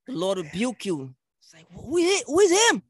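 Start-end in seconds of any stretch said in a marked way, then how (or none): 1.7-2.8 high-pitched mock disbelief, louder on the repeat; incredulous challenge. Acting out someone questioning a stranger's authority, incredulous challenge. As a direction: challenge it like you can't believe it.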